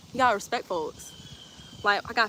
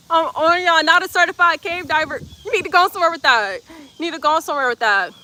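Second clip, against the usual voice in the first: higher, louder laughing scolding; 6 semitones higher, 5 dB louder than usual. Real, not acted. Streamer laughing while scolding chat over a comment.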